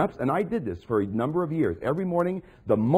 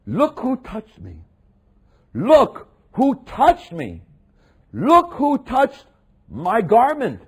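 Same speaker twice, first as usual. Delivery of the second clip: slow, higher and louder with each repeat; Jesus calling out; 8 semitones higher, 8 dB louder, much slower than usual. Acted. Preacher as Jesus calling to the crowd, building to a loud cry. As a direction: build the call, drawing each word out.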